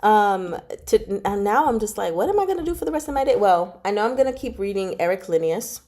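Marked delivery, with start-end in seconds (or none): none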